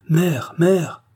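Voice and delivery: high calling cry to her mother. A narrator's reading. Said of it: "cria-t-elle".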